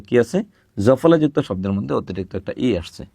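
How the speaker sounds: ordinary talk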